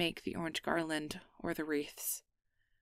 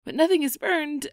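louder and higher, protesting; defensive; 10 semitones higher, 12 dB louder than usual. Acted. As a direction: insist nothing burned, raised pitch. Defensive about the burnt food.

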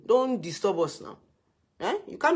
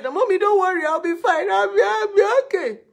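high, strained voice, louder; tearful reassurance; 13 semitones higher, 7 dB louder than usual. Retold or imitated. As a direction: pretend you're fine through tears. Younger self reassuring mother.

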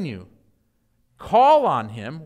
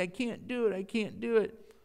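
soft, mock whiny complaint; a hammed-up whimper of giving up; whining; 8 dB quieter than usual. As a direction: imitate a sulky quitter. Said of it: "we complain and we whine".

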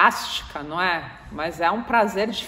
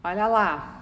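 gloating remark; slower than usual. Retold or imitated. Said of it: "você fala".

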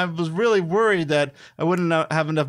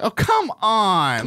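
higher, louder, slow drawn-out complaint; frustrated groan; 6 semitones higher, 4 dB louder, much slower than usual. Real, not acted. Losing player groaning at the spinner, exasperated. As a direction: drag out the frustration.

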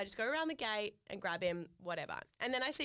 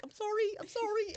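high, loud, flustered sorry; embarrassed hurried apology; sheepish apology; 11 semitones higher, 5 dB louder than usual. Retold or imitated. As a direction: squeak out sorry as you duck away. Apologising while sneaking away.

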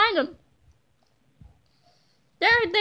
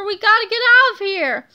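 loud, higher urgent plea; panicked cry; 5 semitones higher, 7 dB louder than usual. A narrator's reading. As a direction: fear of being caught. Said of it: "cried Harold".

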